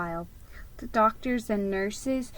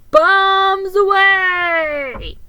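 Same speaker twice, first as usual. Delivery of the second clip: put-on high, slow, much louder boy's shout; Ricky yelling, far louder and higher; yelled; 10 semitones higher, 10 dB louder, much slower than usual. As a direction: holler it from the window like a gleeful kid. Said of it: "yelled Ricky".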